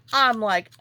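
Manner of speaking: normal talking voice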